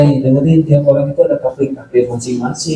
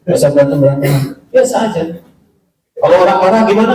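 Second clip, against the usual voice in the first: usual speaking voice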